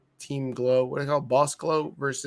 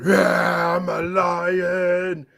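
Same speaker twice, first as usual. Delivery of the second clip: loud, drawn-out mock roar; slow, growling put-on voice; Veer; 3 semitones higher, 6 dB louder, much slower than usual. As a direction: roar it out like a hammed-up wrestler. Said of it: "And Veer said".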